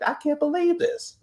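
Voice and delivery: high-pitched mock disbelief, pitch jumping way up. Caller retelling her reaction to the fight, high disbelief. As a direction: squeal it out in shock. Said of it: "I said".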